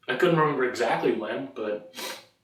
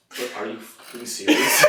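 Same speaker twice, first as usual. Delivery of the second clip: laughing. Real, not acted.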